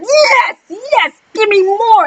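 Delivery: high, drawn-out fan cries; thrilled cheering. Retold or imitated. Acting out a thrilled viewer.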